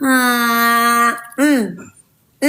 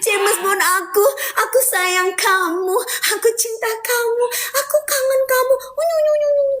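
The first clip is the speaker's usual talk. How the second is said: cutesy gushing; 12 semitones higher than usual. Acted. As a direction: coo over her beloved like a lovesick girl. Gushing over her James Bond. (manja bermesraan dengan nada tinggi)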